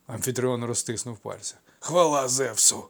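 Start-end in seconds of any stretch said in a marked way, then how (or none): 1.8-2.8 happy wheeze. A narrator's reading. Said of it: "щасливо просипів".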